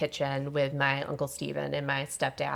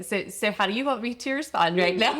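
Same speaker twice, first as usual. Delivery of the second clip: higher, laughing quote of a flustered friend; mock flustered friend; 6 semitones higher, 5 dB louder than usual. Imitating her friend's flustered question, laughing. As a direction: play her caught off guard, laughing. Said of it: "she's, of course, like".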